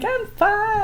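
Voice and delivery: very high, airy unbothered; breezy dismissal. Retold or imitated. Wife dismissing husband's panic, very high breezy. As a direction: wave off the worry. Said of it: "I'm just like".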